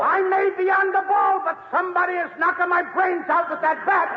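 high-pitched, loud outburst; comic loud protest. Acted. Comic complaining to his partner about his knocked brains, loud protest.

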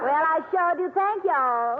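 put-on Southern accent. New singer thanking the two comics, Southern accent. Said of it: "I detect a little Southern accent".

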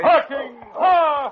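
loud, high command to turn; sharp musher's cry; ringing cry. Acted. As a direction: ring it out across the ice. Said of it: "Sergeant Preston's ringing cry".

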